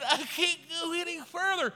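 high, whiny mock whimper; mimicking crying recruit. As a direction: imitate a tearful quitter. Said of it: "The guy who just said".

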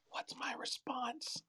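breathy stage whisper. Acted. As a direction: whisper it like a secret aside. Aside whisper after the quote.

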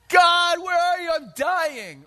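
hammed-up frantic plea; panicked cry to God. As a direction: wail it like a scared man in a sinking boat. Mocking a panicked disciple crying out.

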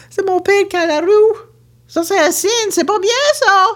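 high, loud alarm at a bad sign. Alarm: father driving. (alarme aiguë et forte)

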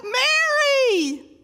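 high, loud, ringing call; calling her name. Acted. Jesus calling Mary's name.

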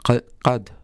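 "qad" pronounced carefully on its own; careful pronunciation. Shown as a demonstration. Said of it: "how to pronounce things properly".

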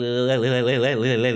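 ordinary talk

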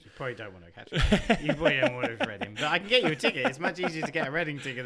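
laughing. Real, not acted. Teasing about Reading, laughing.

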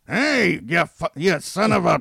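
big, high cartoon holler; loud cartoon-character outburst, cut short; Fred Flintstone. Host mimicking the TV overdub of a curse, cartoon holler. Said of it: "got the voice actor for Fred Flintstone".

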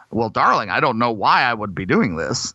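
unconvincing American accent, as done by a Brit; British-faked American accent. Shown as a demonstration. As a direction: play a British actor attempting an American accent. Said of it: "my version of what British people trying to do an American accent".

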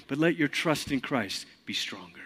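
soft, settled restatement; quiet repeat. Shown as a demonstration. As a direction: bring it down and say it quietly.

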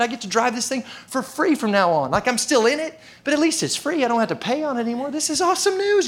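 high-pitched, hammed-up elation; so excited. Customer walking out with a free clunker, all excited. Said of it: "you're so excited".

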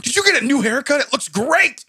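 high, loud mock praise; aggressive compliment. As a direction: fire the compliment at her.

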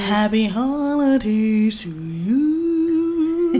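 stretched slowly, sing-song; slow drawn-out. Real, not acted. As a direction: drag the greeting out playfully. Drawn-out sign-off greeting.

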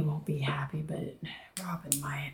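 hushed whispered aside; whispering. Real, not acted. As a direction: whisper it conspiratorially.

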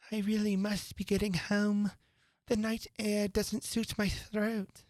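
put-on high, slow, fussy old-bird voice; old magpie. A narrator's reading. Old Magpie excuses itself from the party, high and fussy. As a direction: make a careful excuse to leave. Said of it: "One old magpie began wrapping itself up very carefully".

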